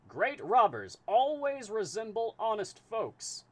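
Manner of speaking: put-on high, loud transatlantic accent; booming high transatlantic detective; transatlantic voice for Fix. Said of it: "need a voice for Fix".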